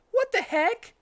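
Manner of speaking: very high, loud indignant squeak; Tails outraged. A narrator's reading. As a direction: squeal it out in disbelief.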